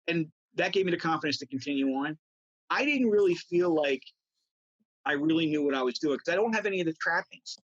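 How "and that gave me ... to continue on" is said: usual speaking voice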